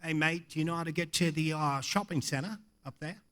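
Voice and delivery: put-on low, soft Australian accent; casual Australian asking directions; Australian guy. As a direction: imitate an Australian tourist, low-voiced. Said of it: "this Australian guy sits down and he says".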